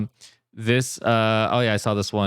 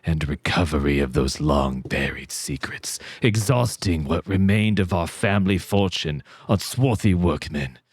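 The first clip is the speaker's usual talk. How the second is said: spooky voice; 4 semitones lower than usual. Acted. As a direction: lean into the dark menace. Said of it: "triggered someone's A S M R".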